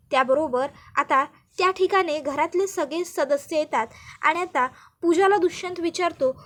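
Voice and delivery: usual speaking voice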